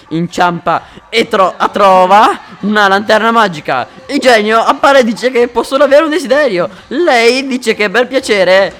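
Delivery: big, loud storyteller voice; loud joke telling. Acted. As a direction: belt the joke out to the room. Tells joke with energy.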